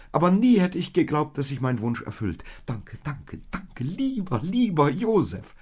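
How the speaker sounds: high, loud, tumbling outburst of delight; overjoyed. A narrator's reading. Maria sees the donkey she always wished for, overjoyed.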